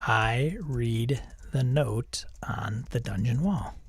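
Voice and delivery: slow whispered command; hushed, slow reading aloud. Real, not acted. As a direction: whisper it slowly as you type.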